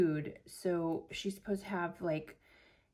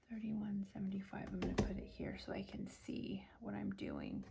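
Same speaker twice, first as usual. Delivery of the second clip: whispering; 9 dB quieter, faster than usual. Real, not acted. Whispering while entering amounts.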